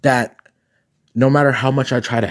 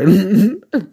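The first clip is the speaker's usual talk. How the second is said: high laugh; 9 semitones higher, 4 dB louder than usual. Real, not acted. Laughing at outfit.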